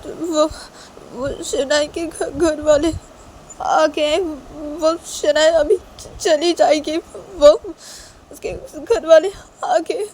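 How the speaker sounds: high, halting acted sobbing; loud, broken, high-pitched weeping voice; tearful mother. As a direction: choke the words out through tears. Crying mother explains to her son.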